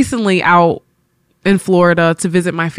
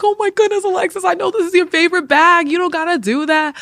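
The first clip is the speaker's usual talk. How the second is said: high, gushing mom voice, much higher; touched mom; 10 semitones higher than usual. Retold or imitated. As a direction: protest like a mother who is deeply touched. Mom moved by gift.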